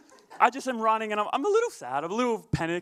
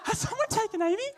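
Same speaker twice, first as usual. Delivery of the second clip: mock panic; 14 semitones higher than usual. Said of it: "because I'm like".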